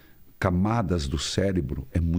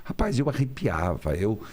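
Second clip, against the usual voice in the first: ordinary delivery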